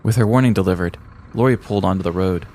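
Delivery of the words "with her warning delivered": usual speaking voice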